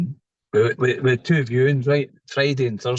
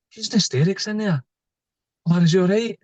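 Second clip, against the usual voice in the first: higher, mock-worried mother-in-law voice; imitating mother-in-law; 8 semitones higher than usual. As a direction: play the fretting mother-in-law, raised pitch. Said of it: "she's like".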